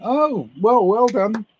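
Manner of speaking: high, loud, swooping praise; hammed-up impressed listener; imitated congratulation. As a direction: mimic someone cheering a achievement. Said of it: "and they went".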